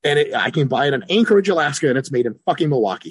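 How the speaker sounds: ordinary talk, nothing marked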